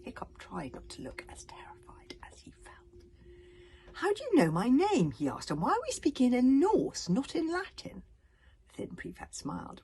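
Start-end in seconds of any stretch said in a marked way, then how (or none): 3.9-8.0 higher, louder startled questions; lightly voiced frightened boy demanding answers; alarmed boy. Hiccup alarmed questioning villain.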